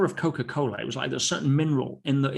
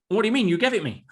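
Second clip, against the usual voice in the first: higher, louder mock indignation; indignant fiancée; 8 semitones higher, 4 dB louder, faster than usual. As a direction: play the fiancée taken aback and protesting. Fiancée protests in retold story.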